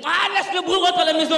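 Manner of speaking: high, loud dismissive heckle; a heckler yelling at the comic to go home. Retold or imitated. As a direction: shoo him off the stage. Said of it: "il me fait".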